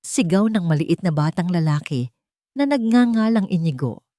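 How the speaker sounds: ordinary delivery, nothing marked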